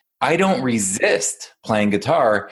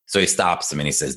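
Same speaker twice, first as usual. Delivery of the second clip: usual speaking voice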